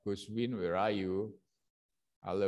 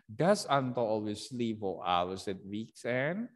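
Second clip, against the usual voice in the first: Does Anto always sleep for hours at weekend with the voice rising at the end; yes-no question, end on a clear rise; rising question tune. Shown as a demonstration. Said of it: "it must be rising intonation".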